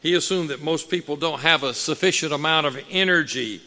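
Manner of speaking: loud, rising preacher's cadence; preaching at full force. Acted. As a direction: preach it out with fire to the congregation.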